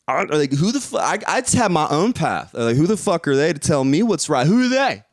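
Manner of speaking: usual speaking voice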